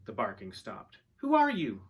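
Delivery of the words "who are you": high, loud demanding question; boy's loud challenge. A narrator's reading. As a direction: call the question out high at a stranger. Boy challenges an armed stranger.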